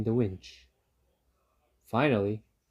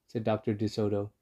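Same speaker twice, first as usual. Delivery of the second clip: ordinary delivery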